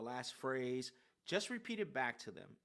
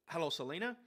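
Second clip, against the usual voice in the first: assertive greeting; 5 semitones higher, 4 dB louder than usual. Shown as a demonstration. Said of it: "it needs to be you, assertive".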